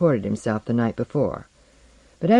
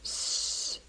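long soft voiceless hiss; imitated static hiss; sardonic radio hiss; 14 dB quieter than usual. A narrator's reading. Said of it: "the radio said sardonically".

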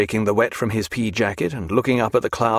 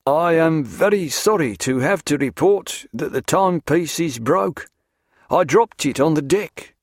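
higher, stiffly strained report; unnatural voice; 5 semitones higher than usual. A narrator's reading. Ship's master reports broken timepiece to captain, unnatural voice. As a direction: confess it in an unnatural, formal voice. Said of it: "said Grimmond in an unnatural voice".

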